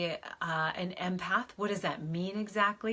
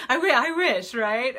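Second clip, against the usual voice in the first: laughing loudly; 5 semitones higher, 10 dB louder than usual. Real, not acted. Laughing burst to viewers.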